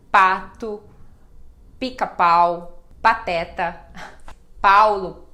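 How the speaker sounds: Pato, Pica-Pau, Pateta, Paulo said loud and clear with a plain P; clear P sound. Shown as a demonstration. Teacher loudly listing P words for students. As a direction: punch each P loudly. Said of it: "não muda".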